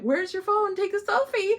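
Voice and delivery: high-pitched mock prompting; teasing viewer. Viewer to Liz posing with paperback, teasing. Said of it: "you're like".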